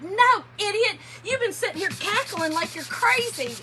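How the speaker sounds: mildly exasperated mock scolding, pitched high; retold scolding. Wife imitating husband's reply in the stands, mock scolding. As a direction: scold as if quoting someone, high and loud. Acts out Jim scolding her.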